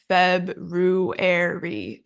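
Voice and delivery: February drawn out slowly as FEB-roo-AIR-ee; American four syllables. Shown as a demonstration. Teacher showing American February in four beats, slow syllable-by-syllable. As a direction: sound out every syllable of February, R after B. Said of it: "whereas in American".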